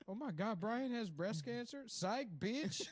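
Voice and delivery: hammed-up shock then taunting; mocking DJ. DJ reveals the prank to the victim, high jeering mock voice. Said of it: "radio prank reveal".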